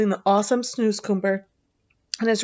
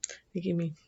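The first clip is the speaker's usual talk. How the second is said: whisper; 3 semitones lower, 11 dB quieter, faster than usual. Real, not acted. Reader aside to listener.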